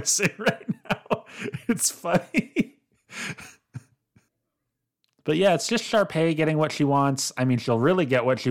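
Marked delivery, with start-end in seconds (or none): 0.0-3.4 laughing. Real, not acted. Laughing about Ryan's relief.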